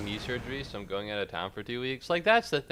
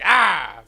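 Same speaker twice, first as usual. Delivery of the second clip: sharp loud cry of pain; pained yelp; 8 semitones higher, 16 dB louder than usual. Retold or imitated. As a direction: yelp like it just hurt. Reenacting pain when bending hand.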